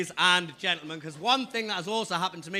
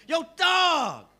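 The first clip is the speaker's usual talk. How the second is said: mixtape salesman voice; 5 semitones higher, slower than usual. Retold or imitated. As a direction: play the friendly Harlem hustler. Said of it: "He goes".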